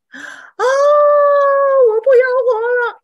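high, loud, slow howling sob; loud wailing cry. Shown as a demonstration. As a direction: wail out loud in despair. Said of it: "wail是哭出来大声的那种". (嚎啕大哭地喊)

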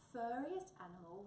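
ordinary delivery, nothing marked

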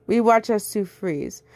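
plain reading voice

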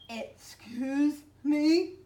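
slow, loud, high monster voice; mucus monster. A narrator's reading. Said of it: "said the mucus monster".

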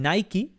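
"Nike" as "NAI-kee"; two-syllable English "Nike", "nai-kee"; English pronunciation. Shown as a demonstration. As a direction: say "Nike" with a final ee. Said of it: "como se dice en inglés".

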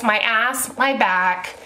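usual speaking voice

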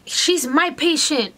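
slow, high, indignant retort; mild mock yell. Doctor retorting to the patient's boyfriend, mild mock yell. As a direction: imitate a doctor drawing out his objection. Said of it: "you do not scream at her".

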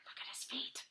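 soft whispered aside. Real, not acted. Reader whispers to the child. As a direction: whisper it to the listener.

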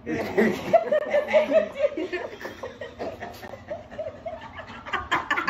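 high, strained laugh through the burn; pained laughter. Real, not acted. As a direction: laugh helplessly through the pain. Pained laugh during chip challenge.

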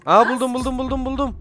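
high, loud rapid-fire repeats; excited discovery. Real, not acted. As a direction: call out the find in a rush. Finds item, calls out excitedly. (heyecanla tiz sesle haykırarak)

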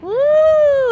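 loud, high woo; cheering whoop. Real, not acted. Cheering a child's uphill climb, whooping. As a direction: let out a long, high whoop.

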